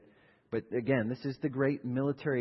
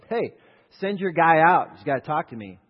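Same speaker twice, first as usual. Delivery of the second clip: demanding shout; 5 semitones higher, 12 dB louder than usual. Retold or imitated. Commander demands at the gate.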